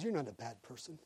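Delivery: very soft reassurance; imitated softened Marine. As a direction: play a drill sergeant gone gentle, almost under the breath. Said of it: "And he switched".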